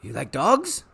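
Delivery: eager, wheedling showman; a salesman tempting the boy; Rictus voice. Acted. Rictus tempting Harvey with pets, eager showman voice.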